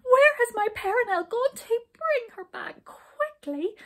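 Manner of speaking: high, urgent wail; distressed queen. A narrator's reading. Queen begging for Perenelle back, high distressed cry. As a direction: plead loudly at a high pitch in distress. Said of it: "cried the queen, jumping up in terrible distress".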